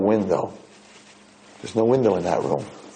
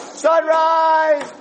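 high, drawn-out mock shout; incredulous cry; 3 dB louder than usual. Retelling a man's reaction to being woken at sunrise, incredulous cry. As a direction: cry it out in one long high note. Retold disbelief at a sunrise wake-up.

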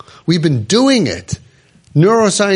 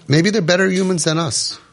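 ordinary talk

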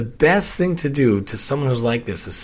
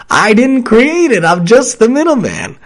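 exasperated protest; 9 semitones higher, 6 dB louder than usual. Real, not acted. Man protesting to nasty email writers, loud and exasperated. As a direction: plead your innocence, fed up. Defending himself against accusers.

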